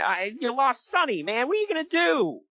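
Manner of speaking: high, rising mock lament; hammed-up distress; mock dismay. Mock commiseration with dumped wrestler.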